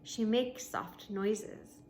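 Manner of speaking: ordinary delivery, nothing marked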